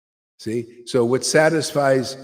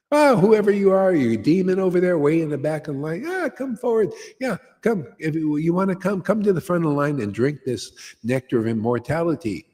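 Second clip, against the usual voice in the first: mock beckoning call; 7 semitones higher than usual. Calling demon to the front.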